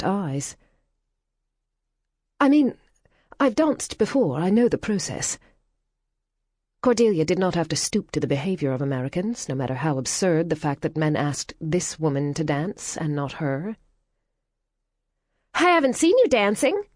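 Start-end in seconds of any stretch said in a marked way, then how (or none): 2.4-5.4 put-on flustered American girl. Flustered American answers back. 15.5-16.8 high, loud, chirpy remark; blunt American girl. Acted.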